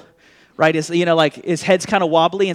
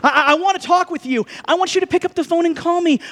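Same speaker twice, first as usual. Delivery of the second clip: eager pleading Jesus; 9 semitones higher, 4 dB louder than usual. Retold or imitated. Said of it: "Jesus is kinda like".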